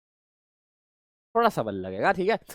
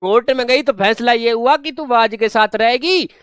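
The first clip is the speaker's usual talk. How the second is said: emphatic; 6 semitones higher, 4 dB louder than usual. Shown as a demonstration. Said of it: "देख ध्यान से सुनना ये बात".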